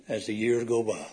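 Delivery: ordinary talk, nothing marked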